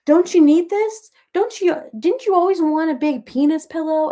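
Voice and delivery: imitating a son's loud, high-pitched teasing. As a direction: goad mom like a son out to embarrass her. Son teasing mom with a pillow.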